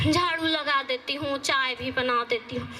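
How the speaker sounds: ordinary talk, nothing marked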